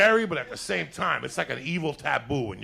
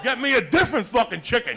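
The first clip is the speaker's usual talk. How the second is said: demanding shout; 8 semitones higher, 5 dB louder than usual. Retold or imitated. Demanding another chicken.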